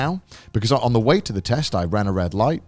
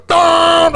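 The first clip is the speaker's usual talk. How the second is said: loud lorry noise; 10 dB louder than usual. Retold or imitated. Lorry roaring past on hill.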